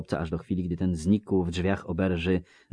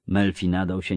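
plain reading voice

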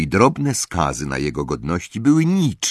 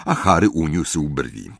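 usual speaking voice

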